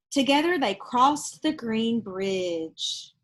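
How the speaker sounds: put-on lively read-aloud voice for children; storybook reading. Reading a picture book aloud to children, lively storytelling. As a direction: tell it like a bedtime picture book.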